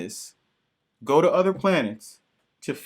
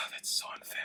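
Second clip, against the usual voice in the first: breathy sighed whisper, much softer; whispered complaint; 18 dB quieter, faster than usual. Real, not acted. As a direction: sigh it out under your breath. Sighing complaint about comic.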